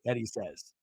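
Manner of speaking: ordinary talk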